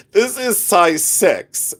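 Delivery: 1 laughing fake. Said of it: "while I fake laugh".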